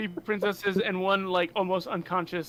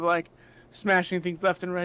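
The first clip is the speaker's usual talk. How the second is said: normal talking voice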